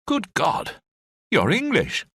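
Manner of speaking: high, startled Scottish exclamation; a Scottish officer amazed the prisoner is English; astonished Scottish officer. A narrator's reading. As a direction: gasp it out in Scottish surprise. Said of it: "The Scottish officer gawped".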